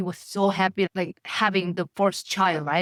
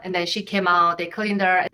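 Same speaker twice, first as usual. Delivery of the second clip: ordinary delivery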